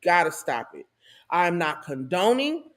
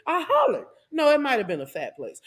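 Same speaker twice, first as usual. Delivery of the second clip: ordinary delivery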